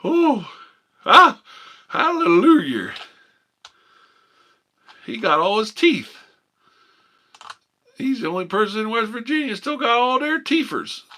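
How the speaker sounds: very high, loud, hammed-up Appalachian hollering; hillbilly voice. Streamer clowning as a West Virginia local, high and shouting. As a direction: squeal it like a yokel spotting a monkey.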